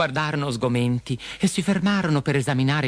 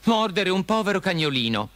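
high-pitched scolding of a big bully; high, reproachful Dorothy; indignant scolding; 9 semitones higher than usual. A narrator's reading. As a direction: shame the listener, voice raised in pitch. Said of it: "gridò".

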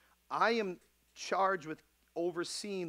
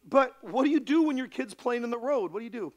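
loud, high, driving pulpit delivery; rising fiery sermon voice; preaching at full force; 9 semitones higher, 12 dB louder than usual. Acted. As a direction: preach it hard to the flock.

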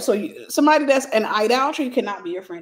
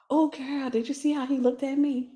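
put-on pick-me girl; 4 semitones higher, 5 dB quieter than usual. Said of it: "That pick me, that girl".

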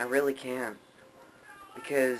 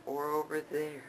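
higher, softer second guess; unsure pointing; 5 semitones higher, 5 dB quieter than usual. Shown as a demonstration. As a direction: try another direction, doubtful. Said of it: "camera's reversed, pointing around".

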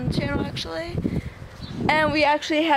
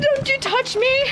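much higher, squealed warning; squeamish warning; 12 semitones higher than usual. Real, not acted. Owner fending off a dog that might carry ticks, squealed high. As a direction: keep the dog away, squeamish.

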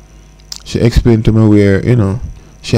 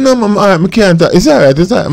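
higher voiced quote; 9 semitones higher, faster than usual. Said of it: "she said".

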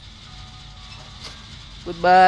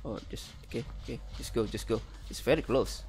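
tense whisper; 6 semitones lower, 14 dB quieter, much faster than usual. Real, not acted. Tense moment near the killer.

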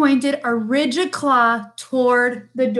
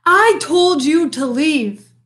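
raised, scolding bear voice; crying out; 4 semitones higher, 4 dB louder than usual. A narrator's reading. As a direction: call it out as an annoyed bear. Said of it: "cried Bear".

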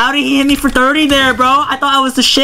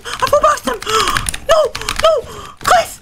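shocked high cry; 12 semitones higher, 4 dB quieter than usual. Real, not acted. Disbelief after the hit.

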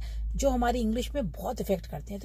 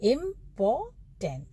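Important slow and higher, in three separate beats; three stresses; 4 semitones higher, much slower than usual. Shown as a demonstration. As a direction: stretch each of the three syllables of important. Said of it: "तीन stress आ रहे हैं इसमें".

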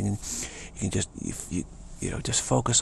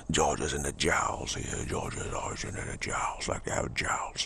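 Georgia Southern accent; 8 semitones lower, 5 dB quieter than usual. Shown as a demonstration. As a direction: talk Georgian from heavy jowls. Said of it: "every state has a different Southern, Southern accent".

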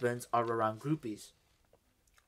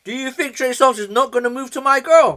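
hyped shouting rant; 11 semitones higher, 14 dB louder than usual. Pretend.

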